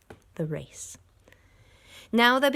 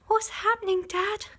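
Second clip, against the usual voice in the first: much higher, scared little voice; frightened cry. A narrator's reading. Small foxes crying out to their dad in fear. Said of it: "cried the small foxes".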